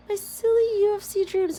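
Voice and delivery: high excited squeal; giddy. Real, not acted. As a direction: gush over the silly dream.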